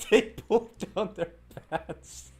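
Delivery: high, loud words through real laughter; slow, helplessly amused delivery; laughing. Real, not acted. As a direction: crack up while talking. Cracking up at game enemies.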